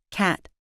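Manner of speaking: "cat" with the final t stopped, not released; unreleased T. Shown as a demonstration. Teacher contrasts cat with cad, "cat" with unreleased t. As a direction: hold back the release at the end of "cat". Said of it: "The first one isn't released".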